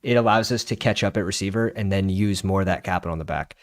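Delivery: ordinary delivery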